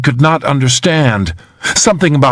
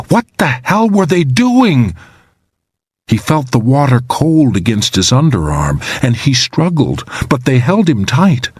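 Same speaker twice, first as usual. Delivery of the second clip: ordinary delivery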